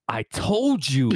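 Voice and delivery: put-on slow, drawn-out kid's grumble; imitating angry kid. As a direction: act out a fired-up little boy. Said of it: "the one thing he says".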